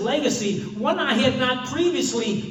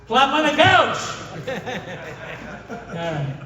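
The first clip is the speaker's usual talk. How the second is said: laughing; 3 dB louder than usual. Real, not acted. Punchline, laughing.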